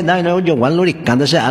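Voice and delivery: usual speaking voice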